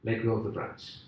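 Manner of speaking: low, soft, matter-of-fact instruction; calm God. Acted. God instructs the dangling man, low and calm. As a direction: say it quietly as God, in one smooth run.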